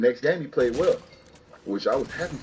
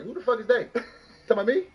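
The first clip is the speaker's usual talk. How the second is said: mock outrage; 10 semitones higher than usual. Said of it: "All I heard that motherfucker say".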